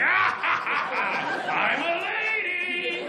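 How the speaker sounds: high, slow, sung shout of a lady; mocking the bodybuilder as she throws a car, high and sung; hammed-up lady roar. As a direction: bellow then sing out like a proud giant. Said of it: "impression of her".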